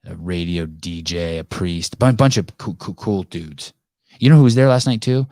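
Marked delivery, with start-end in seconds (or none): 4.2-5.3 sudden excited jump up, faster and louder. Real, not acted. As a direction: burst out with the news. Excited podcast host reveal.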